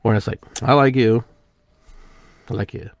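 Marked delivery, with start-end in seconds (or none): 0.6-1.2 louder, punchy I like you; emphatic inflection. Shown as a demonstration. Contrasting ways of saying I like you, loudly. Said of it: "English language is a lot about inflection". 2.5-2.9 quieter, understated I like you; soft inflection. Shown as a demonstration. Contrasting ways of saying I like you, softly. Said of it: "English language is a lot about inflection".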